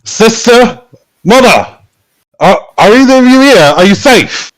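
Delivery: high, loud calling out; booming voice. Acted. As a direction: call out across the building. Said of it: "booming voice".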